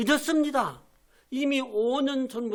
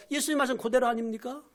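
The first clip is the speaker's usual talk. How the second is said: ordinary talk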